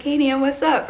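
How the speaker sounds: high, loud hello; upbeat greeting. Retold or imitated. Grandchild greeting confused grandmother on video, upbeat. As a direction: call out an upbeat greeting to a relative.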